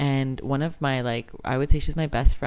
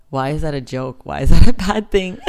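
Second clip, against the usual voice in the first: indignant; 2 semitones higher, 11 dB louder than usual. Retold or imitated. Retorting to a racist caller.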